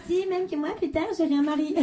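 put-on high, insistent little girl. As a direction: play a stubborn little girl. (petite fille qui insiste)

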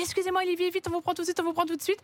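urgent, apologetic hurry, lightly acted; hurried phone call. Retelling a rushed call to put a caller on air, hurried. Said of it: "Donc en vitesse, je rappelle le deuxième Olivier".

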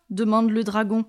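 usual speaking voice

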